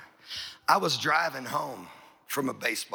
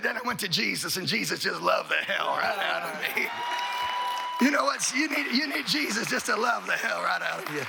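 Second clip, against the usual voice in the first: preaching at full force; 8 semitones higher than usual. Acted. Preacher driving home the point to congregation, shouting. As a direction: build it into a shout. Preaching climax, Jesus loved.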